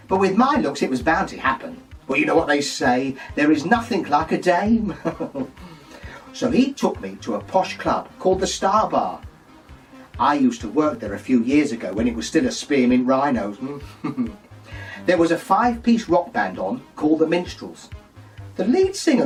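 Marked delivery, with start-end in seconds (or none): 5.0-5.4 cackle. Real, not acted. Laughs at own joke. 13.6-14.3 cackle. Real, not acted. Laughs at own joke.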